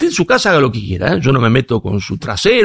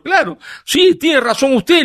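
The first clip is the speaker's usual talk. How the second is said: much higher teasing claro, sí; high mock agreement; 11 semitones higher, slower than usual. Speaker answering the student, in a high ironic voice. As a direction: play along, pitched high and ironic. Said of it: "le digo".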